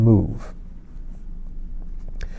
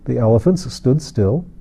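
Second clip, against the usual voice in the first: plain reading voice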